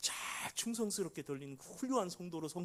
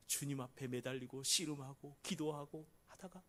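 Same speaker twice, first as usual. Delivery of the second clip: normal talking voice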